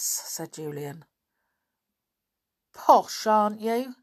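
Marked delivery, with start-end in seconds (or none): 2.7-4.0 loud, higher mocking jeer; sneering. A narrator's reading. Lou sneering at Julian, loud mocking jeer. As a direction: sneer it at the posh kids. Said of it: "said Lou sneeringly".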